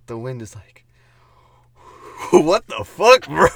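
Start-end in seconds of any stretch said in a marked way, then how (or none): 2.3-3.5 shouted what the fuck bro; mock yell. Friend voicing the wind in a joke story, loud mock yell. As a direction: bellow it like an outraged wind.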